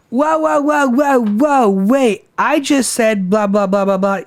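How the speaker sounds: put-on drunken whine, starting high and easing down; imitated drunken tirade. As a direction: imitate a wasted woman going off. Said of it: "going off on him 'cause she's wasted".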